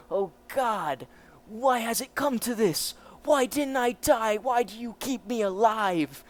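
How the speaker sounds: high, pleading cry of despair; anguished lament. Acted. Captive to his captor on being kept alive, anguished.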